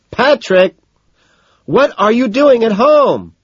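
high, loud, drawn-out demand; angry shouting. A narrator's reading. Evelyn catches her boyfriend still at home, angry shouting. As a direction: demand an answer at full pitch.

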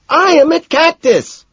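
very high, slow, forceful seething; angry hiss. A narrator's reading. Evelyn tells him where she waits, angry hiss. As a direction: bite out every word at high pitch. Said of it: "she hisses".

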